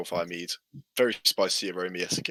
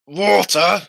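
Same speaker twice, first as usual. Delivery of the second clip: put-on drunk old man; 5 semitones higher, 7 dB louder, slower than usual. Drunk man scoffs at water.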